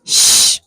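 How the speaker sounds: a long hushing shh; shushing. Acted. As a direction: shush the room to silence. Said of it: "façam silêncio".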